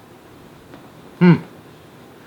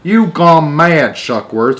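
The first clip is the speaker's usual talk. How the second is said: slow, loud, higher angry shout; 5 semitones higher, 4 dB louder, slower than usual. Acted. Ground control to Shuckworth, shouting slowly. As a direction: shout him down. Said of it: "shouted ground control".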